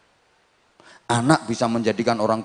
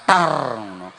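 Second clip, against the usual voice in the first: normal talking voice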